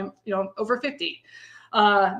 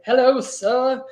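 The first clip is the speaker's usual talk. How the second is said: mock old-time Southern gentleman's accent; 2 semitones higher, 5 dB louder than usual. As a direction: hail a gentleman in a put-on Southern drawl. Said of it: "put a Southern accent on that".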